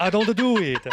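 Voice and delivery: mock Italian accent through laughter; mocking Italian imitation. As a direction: mimic him as a silly Italian, amused. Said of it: "Isn't that what he sounded like?".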